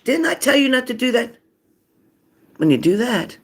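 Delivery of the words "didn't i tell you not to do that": high-pitched, insistent mock scolding; parent's scolding yell. The usual telling-off kids expect, high scolding yell. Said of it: "if you sit here and say".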